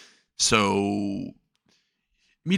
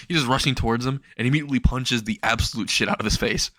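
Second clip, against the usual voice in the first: usual speaking voice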